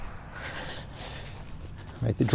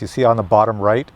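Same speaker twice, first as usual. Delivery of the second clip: ordinary talk, nothing marked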